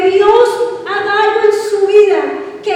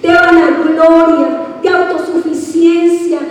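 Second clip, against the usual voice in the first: louder, pressing sermon; fiery preaching; 3 semitones lower, 4 dB louder than usual. Real, not acted. Pastor rousing the congregation, louder and pressing. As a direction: drive it home to the church.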